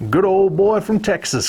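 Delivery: put-on good old boy Southern accent; demonstrating a Southern US accent from Texas. Shown as a demonstration. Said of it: "like this".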